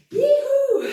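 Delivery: high, loud whoop; excited cheer. Real, not acted. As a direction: whoop for the finished set. Cheering as the set ends.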